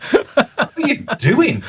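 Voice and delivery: high, slow, laughing disbelief; stunned laughing question; retold astonishment. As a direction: squeal it out in shock while laughing. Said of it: "And I'm like".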